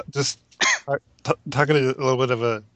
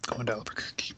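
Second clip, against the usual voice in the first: whispering; 3 semitones lower, 13 dB quieter than usual. Acted. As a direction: whisper it like a secret. Spooky whispered tease.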